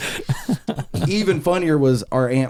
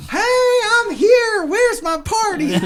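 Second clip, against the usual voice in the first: very high, drawn-out whooping call; crazy old lady; slower than usual. Retold or imitated. Great-aunt walking back to electronics, imitated crazy old lady holler. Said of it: "she's like".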